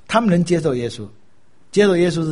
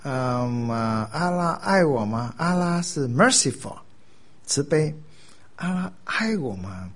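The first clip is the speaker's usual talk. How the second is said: slow, hesitant pondering; puzzled, drawn-out musing; mulling it over; 3 dB quieter, slower than usual. Acted. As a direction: think aloud slowly, unsure of the answer. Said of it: "穆斯林会想来想去".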